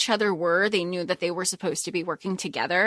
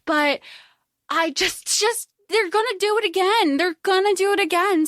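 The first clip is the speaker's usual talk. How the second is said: exasperated rant; 9 semitones higher than usual. Real, not acted. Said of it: "ranting exasperation".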